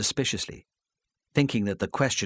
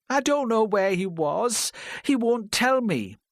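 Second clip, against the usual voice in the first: high, slow, tearful pleading; slow and tearful, near sobbing; crying; 12 semitones higher than usual. A narrator's reading. Wife breaking down over her husband, tearful. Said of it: "she said, and began to cry".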